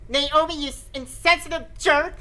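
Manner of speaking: high indignant outburst; hammed-up furious insult; mock angry yell. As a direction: snap at your friend. Character yells at Naomi.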